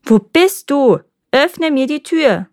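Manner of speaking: wife calling for her husband; insistent call. A narrator's reading. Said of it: "Da rief sie zum dritten Mal".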